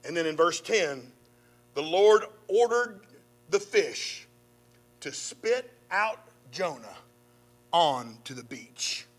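measured, dramatic Bible reading; preacher reading scripture with rising drama. Acted. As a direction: read the story to the congregation. Reads Jonah's story aloud.

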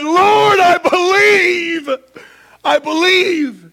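high, tearful shout of faith; preacher acting a father's anguished cry. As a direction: cry it out loud, desperate and drawn out. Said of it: "the father of the child cried out".

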